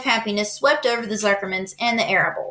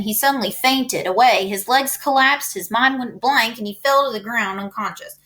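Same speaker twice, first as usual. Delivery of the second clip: ordinary delivery, nothing marked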